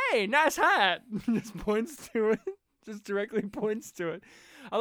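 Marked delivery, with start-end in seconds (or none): 0.1-1.0 higher and louder, a hammed-up hey; mock call-out. John Goodman calling out the hat, higher and louder. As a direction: shout it across the room at the hat. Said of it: "And John Goodman goes".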